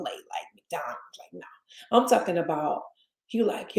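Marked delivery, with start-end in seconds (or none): 0.0-2.0 whispered dismissal. Real, not acted. Whispered dismissal of fast food.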